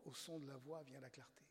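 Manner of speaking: «au son de la voix vient la clarté» soufflé à voix basse, sans timbre; chuchoté, savouré. Shown as a demonstration. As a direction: murmure la phrase comme un trésor. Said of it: "vous vous rendez compte". (chuchoter «au son de la voix vient la clarté» avec émerveillement)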